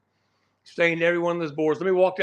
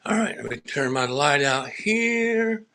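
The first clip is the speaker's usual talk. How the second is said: ordinary talk, nothing marked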